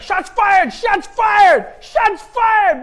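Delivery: high, full-force shouting; frantic high-pitched police shout; panicked cop alarm. Acted. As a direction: yell it like an officer under fire. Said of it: "They'd be like".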